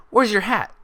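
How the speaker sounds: high, loud blurted question; nervous high near-shout. Acted. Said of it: "Twilight nearly shouted".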